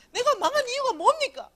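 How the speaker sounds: loud, very high outraged cry; ranting demand. Retold or imitated. Speaker re-enacting her fuss before God, loudly demanding. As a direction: demand the reason at full pitch. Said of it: "이러면서 막 난리를 친 거죠".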